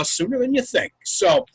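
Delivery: ordinary talk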